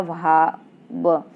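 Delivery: भाव stretched very slowly; slow demonstration of भाव; drawn out. Shown as a demonstration. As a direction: draw out भाव syllable by syllable to show how it joins. Showing how र and भा join.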